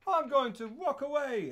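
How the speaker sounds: high, drawn-out announcement; sing-song high movie-heroine parody; mock heroine voice. Acting out film heroine's line.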